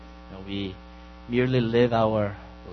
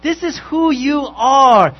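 slower, louder and higher, ringing out; emphatic declaration; 11 semitones higher, 12 dB louder, slower than usual. Acted. Pastor voicing Jesus to the disciples, loud and slow. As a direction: proclaim it as a calling. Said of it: "Jesus is saying".

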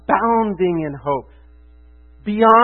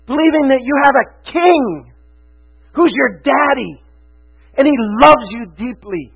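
loud, higher-pitched, emphatic preaching; impassioned preaching; 5 semitones higher, 7 dB louder than usual. Real, not acted. Preacher urging the congregation to believe the gospel, impassioned. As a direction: drive each phrase home at full force. Preacher building to passionate climax.

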